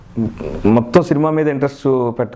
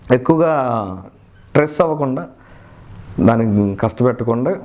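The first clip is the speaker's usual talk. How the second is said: usual speaking voice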